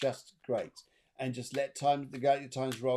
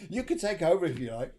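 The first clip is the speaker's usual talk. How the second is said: laughing; 5 semitones higher, 3 dB louder than usual. Real, not acted. Laughing at own singing.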